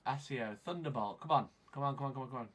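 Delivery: ordinary talk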